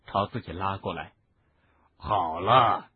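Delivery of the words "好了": thick, slurred word. A narrator's reading. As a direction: say it through a hurt nose. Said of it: "海格口齿不清地说".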